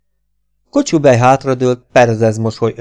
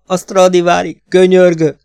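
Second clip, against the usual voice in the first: much higher and louder, begging outright; desperate loud plea; 8 semitones higher, 7 dB louder than usual. Acted. Kocsubej begs Szól to spare his Stradivari, crying out. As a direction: cry out for the violin's sake. Violin balanced at the window.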